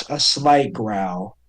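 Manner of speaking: ordinary talk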